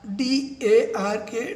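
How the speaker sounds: letter by letter, slow and clear for students; slow spelling. Shown as a demonstration. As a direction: spell each letter out slowly. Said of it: "spelling out for students".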